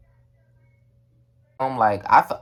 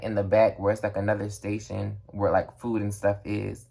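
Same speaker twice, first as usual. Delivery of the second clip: usual speaking voice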